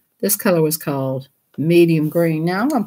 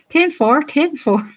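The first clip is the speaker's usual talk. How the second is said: higher mock trucker; 9 semitones higher than usual. Repeats higher, louder.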